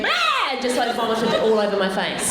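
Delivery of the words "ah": put-on toddler's shriek. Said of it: "looked at me and went".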